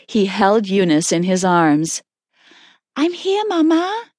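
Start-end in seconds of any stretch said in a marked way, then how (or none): 2.9-4.0 put-on small high child's voice, calm; calm little daughter. A narrator's reading. Daughter answering her frightened mother, calm. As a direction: reassure Mama in a high little voice. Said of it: "Eunice said calmly".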